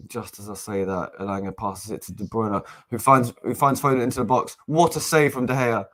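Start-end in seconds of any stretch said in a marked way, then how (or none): none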